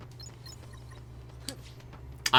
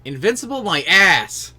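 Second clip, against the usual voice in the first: defiant; 7 semitones higher, 10 dB louder, slower than usual. Real, not acted. Scoffs at game dialogue.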